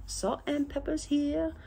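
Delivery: high, soft comic voice; silly put-on voice. Showing viewers salt and pepper, silly put-on voice. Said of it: "please, [name], don't ever do that again".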